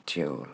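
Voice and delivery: DUAL as a low, soft "dju-ol"; soft falling "dual" after the stress; unstressed ending. Shown as a demonstration. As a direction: drop away on the tail "dual". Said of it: "il porte l'accent tonique".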